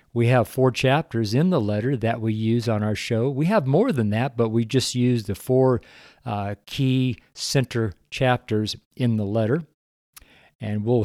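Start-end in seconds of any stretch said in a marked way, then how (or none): none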